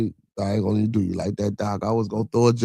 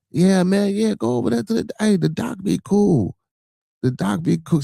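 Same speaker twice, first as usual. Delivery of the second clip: pitched way up and excited; eager high voice of the kid; 9 semitones higher than usual. Retold or imitated. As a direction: hype it up high.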